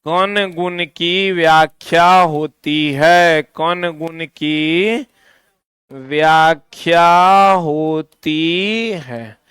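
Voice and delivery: very slow, word by word for copying; slow dictation. Shown as a demonstration. Teacher slowly dictating to students. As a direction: spell it out slowly so students can write. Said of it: "इससे Newton के कण गुण की व्याख्या होती है।".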